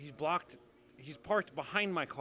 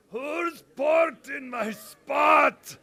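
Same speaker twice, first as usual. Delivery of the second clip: very high, very loud, slow bellow; furious shout; 13 semitones higher, 18 dB louder, much slower than usual. Retold or imitated. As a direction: roar it across the parking lot. Joe yelling about his spot.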